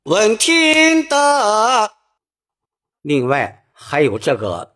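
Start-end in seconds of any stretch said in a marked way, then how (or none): 0.0-1.9 high, slow 闻听得 with level 听; first-tone ting. Shown as a demonstration. Teacher sings 闻听得 with a first-tone 听. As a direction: keep 听 flat and high. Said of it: "按一声来唱".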